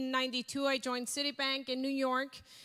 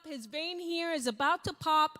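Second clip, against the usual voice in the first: ordinary talk, nothing marked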